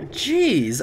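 usual speaking voice

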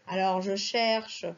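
ordinary talk, nothing marked

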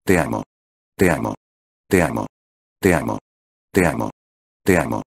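low, murmured love from the shadow; a dark, low murmur that clings; witch's murmur. Acted. The witch's shadow murmurs love to Subaru, low and hushed. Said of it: "los susurros de la sombra negra".

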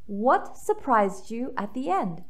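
ordinary delivery

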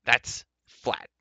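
loud, final pronouncement; ordering. A narrator's reading. Dwarf ends argument with doorkeeper, commanding. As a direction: shut the argument down firmly. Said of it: "he ordered".

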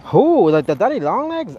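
high, loud who in disbelief; shocked. Real, not acted. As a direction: yelp it out, amazed. Shocked at spider size story.

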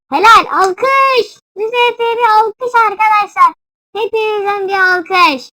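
high, loud call for applause; rousing cheer. Real, not acted. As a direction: whip the audience into clapping. Calls for applause for player. (coşkuyla alkış isteyerek)

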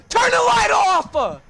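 high, slow shouted order; angry yell. Real, not acted. Gamer yelling at a teammate to kill the light, angry. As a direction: bark it at a careless teammate.

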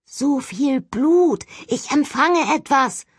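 ghost's slow whisper through rough lips; ghostly rasp. Acted. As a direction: drag it out like a dry-leaf ghost. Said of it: "Eine Stimme, wie trockenes Laub, die flüsternd".

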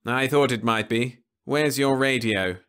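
a hint of calm menace; cool Venusian. Leffler demanding the radio from the crew, cool menace.

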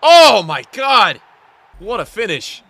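high, loud, drawn-out celebration; thrilled, stretched-out exclamation; amazed shout. Real, not acted. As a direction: yell it in amazement at a goal. Celebrating a great goal.

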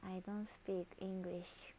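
slow, breathy whisper in English; sheepish whispered admission to viewers. Pretend. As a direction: confess it under your breath, slowly.

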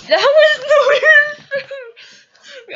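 high, loud, slow laughing wail; helpless high-pitched laughing; laughing hard. Real, not acted. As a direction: squeal it through laughter. Cracking up at the camera.

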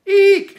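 very loud, high-pitched "eek". A narrator's reading. Little girl practising to make her brothers jump, eeking. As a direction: shriek a sudden piercing playful eek. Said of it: "could hear her eeking".

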